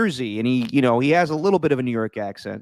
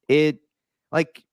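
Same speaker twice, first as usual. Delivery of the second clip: ordinary delivery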